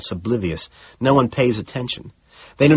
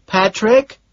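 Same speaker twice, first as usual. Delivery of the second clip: higher, louder questioning call; Gene asking; 12 semitones higher, 5 dB louder than usual. Acted. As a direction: Gene checks if he is there. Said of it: "Gene asks".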